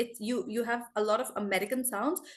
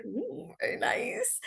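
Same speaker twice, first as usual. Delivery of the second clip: high, soft, slow "ooh" of admiration; impressed cooing; 12 semitones higher, 7 dB quieter, slower than usual. Retold or imitated. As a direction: imitate an impressed audience, hushed and high-pitched. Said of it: "you guys were like".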